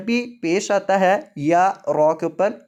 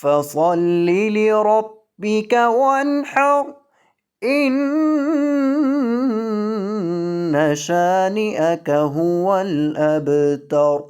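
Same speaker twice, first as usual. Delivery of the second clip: slow, higher, melodic chanted recitation; Quran recitation; 4 semitones higher, much slower than usual. Acted. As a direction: draw each verse out in a long tune. Full surah recitation. (قرآن کی ترتیل، آہستہ اور سریلی)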